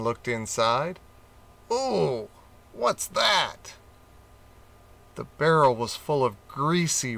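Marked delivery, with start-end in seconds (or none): 1.7-3.7 higher, wondering voice for the gorilla; curious ooh. A narrator's reading. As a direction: gorilla king peeks in, curious and surprised. Gorilla finds strange barrel.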